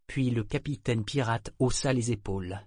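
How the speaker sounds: plain reading voice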